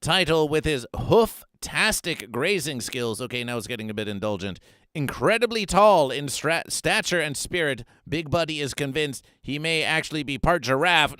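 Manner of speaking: ordinary talk, nothing marked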